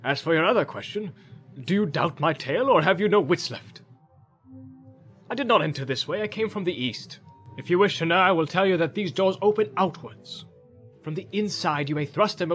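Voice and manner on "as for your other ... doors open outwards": put-on raised, indignant old wizard's retort; higher, emphatic, irritated explanation; testy wizard. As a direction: rebuke the doubter sharply. Wizard testily answers Boromir's challenge.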